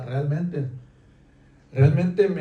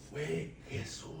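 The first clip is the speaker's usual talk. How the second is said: a slow near-whispered reveal; hushed; 19 dB quieter, much slower than usual. Real, not acted. Preacher reveals the Father's sacrifice to the listener, hushed and slow. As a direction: let it fall to a breath.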